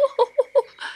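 high, excited whooping giggle; giddy emu laugh. A narrator's reading. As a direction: giggle as if unable to stop.

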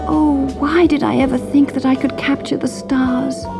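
high, rueful lament; sorry and shivering, miles from home; regretful princess. A narrator's reading. Princess lamenting, cold and lost.

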